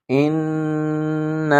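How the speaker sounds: ان with the nun hidden in the nose; nasal ghunna. Shown as a demonstration. Teacher showing students the nasal ghunna. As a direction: hum the نون of ان through the nose. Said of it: "غنہ کہتے ہیں ناک میں آواز چھپانے کو".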